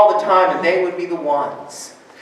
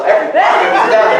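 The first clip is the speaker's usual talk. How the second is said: laughing; 4 semitones higher, 8 dB louder, much faster than usual. Real, not acted. Laughing through the story.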